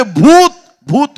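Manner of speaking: high, loud, drawn-out cry; panicked shouting. Acted. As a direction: scream it out like terrified disciples. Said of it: "ते ओरडायला लागले".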